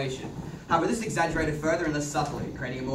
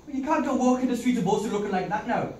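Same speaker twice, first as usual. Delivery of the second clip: put-on Irish accent; 7 semitones higher than usual. Man in Irish accent fussing over a bloodied girl. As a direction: play it broad and rural Irish.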